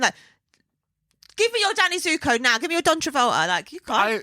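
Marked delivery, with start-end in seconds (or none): 1.4-3.5 mock demanding. Acting out [name]'s demands.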